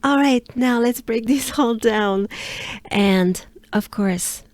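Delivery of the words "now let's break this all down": ordinary talk, nothing marked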